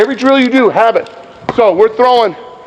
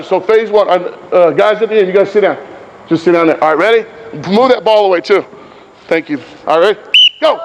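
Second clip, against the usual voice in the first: ordinary delivery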